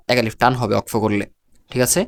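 ordinary talk

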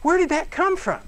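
loud, high whoa, then high-pitched wondering; mock amazement at a child's profundity; acted astonishment. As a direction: blurt it out like you're blown away. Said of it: "And you're going".

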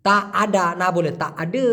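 আডা vs আডে, slowly, ending a then e; pronunciation contrast. Shown as a demonstration. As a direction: set আডা against আডে clearly. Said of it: "আডে বলে".

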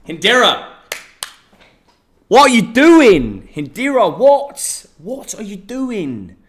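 high, loud what are you doing; hammed-up yelling at a viewer's message; mock outraged yell. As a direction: shout the name in fake shock.

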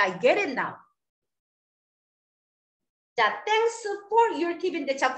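ordinary talk, nothing marked

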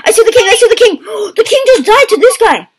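high-pitched, thrilled outburst; excited exclamation. Real, not acted. Player reporting the king's death to friends, excited exclamation. Spots king, sees him die.

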